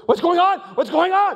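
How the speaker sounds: high, loud panicked yell; acted-out roller coaster panic; panicked shouting. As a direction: shout it twice like a terrified rider. Retold panic inside the dark tunnel.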